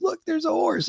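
put-on high, excited little kid calling out; excited kid brother. Brother spotting a horse, high excited kid voice.

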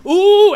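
high loud gleeful ooh; excited ooh. Retold or imitated. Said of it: "excited, like".

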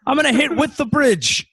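very high-pitched put-on yelling voice; hammed-up defiant character. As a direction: play a stubborn madman on the bridge. Acting out man on burning bridge.

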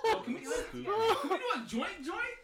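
laughing hard. Real, not acted. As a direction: wheeze through the laughter. Cracking up laughing.

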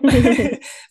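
loud burst of laughter; real laugh. Real, not acted. Host after getting guest's name wrong, loud embarrassed laugh. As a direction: laugh out loud at your own slip.

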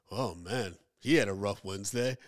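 low, understated mock reaction; imitating a man barely reacting; unreacting. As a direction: shrug it off with no reaction. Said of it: "there's no reaction".

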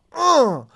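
loud comic grunt of impact; pained grunt. Pretend. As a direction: grunt like you're hit by a car. Hit by a car in story.

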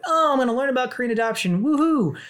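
high-pitched, quick, chirpy mock enthusiasm; mock naive excitement. Adoptee mocking her own expectations of the weekend, mock excitement. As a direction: gush it fast and high, capped with a whoop. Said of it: "this weekend was gonna be like".

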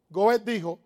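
usual speaking voice

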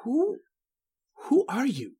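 high, stammered frightened question. Acted. Writer to intruder once lights come on, frightened. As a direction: stammer it with pitch leaping up. Writer sees his villain's scene happen.

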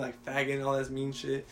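ordinary talk

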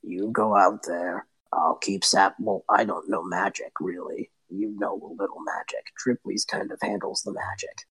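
low and halting, a dull big creature; big dim brute. Acted. The big minion mumbling low to his partner. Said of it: "the big one just goes".

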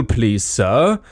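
ordinary delivery

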